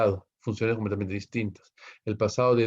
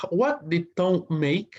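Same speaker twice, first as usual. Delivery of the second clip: slow, high reading of the question; slow teacher read-aloud; 8 semitones higher, slower than usual. Shown as a demonstration. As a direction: read it slowly for the class. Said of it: "teacher reading exercise question".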